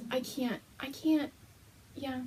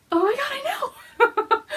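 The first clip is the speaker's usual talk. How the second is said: much higher, louder, laughing through the words; laughing excitement; 6 dB louder, faster than usual. Retold or imitated. Retelling her own reply to Gail, high laughing excitement. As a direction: giggle it out, overjoyed. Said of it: "I'm like".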